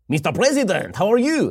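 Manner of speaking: put-on lightly French-accented greeting, pitch rising high at the end; a light French-accented Macron greeting his president; a hint of a French accent; mock French accent. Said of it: "Macron would be like".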